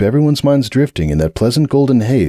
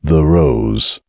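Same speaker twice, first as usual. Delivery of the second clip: deep bouncer; 5 semitones lower than usual. Acted. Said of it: "bass of his voice roll through her".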